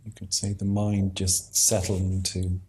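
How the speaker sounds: ordinary talk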